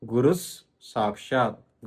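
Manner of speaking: "గురుస్" ends in s, running into "సాక్షాత్"; పొల్లు సా కలిపి. Shown as a demonstration. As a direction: slowly join "గురుస్ సాక్షాత్" through a held s. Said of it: "మొత్తం కలిపితే". ("గురుస్ సాక్షాత్" స్ తో కలిపి నెమ్మదిగా)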